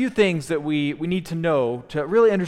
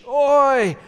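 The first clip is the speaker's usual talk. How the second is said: sharp, high, loud "oi" cry; wail of anguish; 9 semitones higher, 6 dB louder than usual. Shown as a demonstration. Preacher demonstrating the Hebrew cry, high anguished wail. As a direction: make the word a wail. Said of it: "took a wail of anguish".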